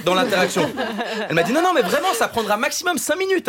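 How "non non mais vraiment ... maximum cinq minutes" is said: high-pitched imitation of a pleading caller; insistent pollster. Columnist mimicking the phone pollster, high and insistent. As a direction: insist in a much higher, imitated voice.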